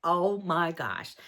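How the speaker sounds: ordinary talk, nothing marked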